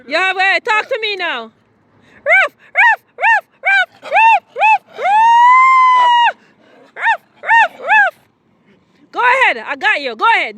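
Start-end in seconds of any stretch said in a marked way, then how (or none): 2.3-8.1 high, loud yappy barking ruff ruff; imitated dog barks. Shown as a demonstration. Barking at the dog to make it bark back. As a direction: bark like a little dog to get the dog going. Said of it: "Now I want you to bark and act out".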